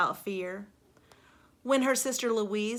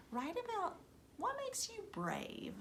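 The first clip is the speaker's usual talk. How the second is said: high, soft whispered prompt; playful whisper; 13 semitones higher, 10 dB quieter than usual. Shown as a demonstration. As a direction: whisper the task like a secret. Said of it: "teacher prompt to class".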